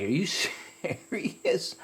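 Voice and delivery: high, laughing "are you serious"; mock her panic with a laugh; laughing at his relative's jalapeno panic; laughing disbelief. Teasing relative who panicked.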